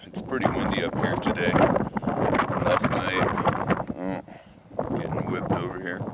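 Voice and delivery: wind in the face. Real, not acted. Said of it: "sorry about the wind".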